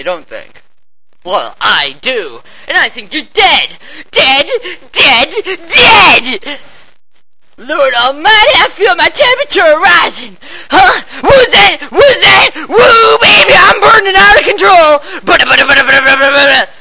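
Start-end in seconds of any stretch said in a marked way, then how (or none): 2.7-6.6 loud gloating. Acted. Gloating over kills. 7.6-15.0 high, loud battle frenzy; wild high screaming; frenzied shout. Acted. 15.2-16.7 gunfire noise. Acted. Machine-gun noise while firing.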